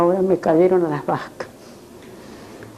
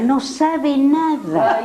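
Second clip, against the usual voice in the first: put-on high, slow, laughing cheeky boy; 6 semitones higher, much slower than usual. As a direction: mimic the cheeky pupil, laughing at the teacher. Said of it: "Y se da vuelta y dice". (burla lenta y aguda del alumno, riendo)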